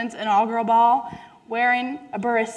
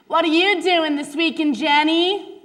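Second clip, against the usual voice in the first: higher, bouncy schoolgirl question; chirpy teen girl; 6 semitones higher than usual. Acted. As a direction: ask it like a preppy classmate. Girl friend voiced in story.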